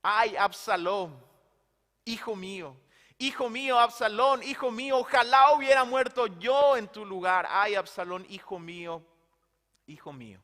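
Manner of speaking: a father's lament, rising to a cry and then fading to almost nothing; weeping lament. Acted. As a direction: mourn the son aloud. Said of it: "lloraba y decía". (lamento de padre, llorando)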